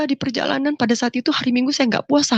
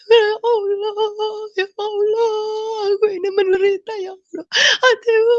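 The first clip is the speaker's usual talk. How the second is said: much higher, slow sobbing plea; crying prayer; 9 semitones higher, slower than usual. Retold or imitated. Reliving her tearful prayer to God, sobbing. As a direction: cry out to God through tears. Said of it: "Saya nangis tersungkur-sungkur".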